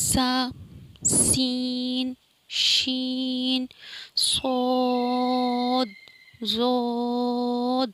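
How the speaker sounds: very slow, drawn-out letter names; stretched letters. Shown as a demonstration. Teacher stretching letter names for the class. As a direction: hold each letter long and loud. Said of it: "ان الفاظوں کو نا کھینچنا نہیں ہے".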